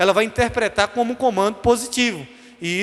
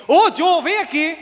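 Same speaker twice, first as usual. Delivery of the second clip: higher, louder sharp summons; angry mother; 7 semitones higher, 4 dB louder, slower than usual. Retold or imitated. Mother angrily summoning her son, raised voice. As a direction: call the child like trouble is coming. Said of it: "quando minha mãe tava com raiva".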